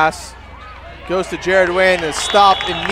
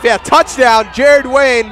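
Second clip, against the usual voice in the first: shouting the touchdown; excited touchdown call; 7 semitones higher, 5 dB louder, slower than usual. Real, not acted. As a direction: yell it, crowd on its feet.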